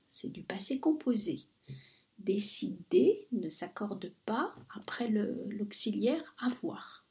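whispered grammar note; whispering. Shown as a demonstration. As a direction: quietly whisper the rule. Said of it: "whispered grammar aside".

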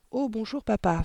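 sugar-coat the greeting; sugary sweet. A narrator's reading. Daughter greeting her furious father, sugary tone. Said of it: "fit-elle d'un ton sucré".

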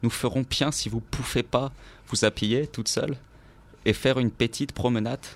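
officer rubbing his hands, mockingly pleased; gloating officer. A narrator's reading. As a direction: savor the cruel joke. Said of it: "en se frottant les mains".

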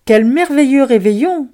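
delighted exclamation. Acted. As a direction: exclaim with joy over the feast. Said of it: "s'exclama Loupiote entre deux bouchées".

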